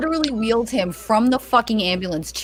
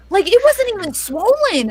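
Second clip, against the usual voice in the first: ordinary delivery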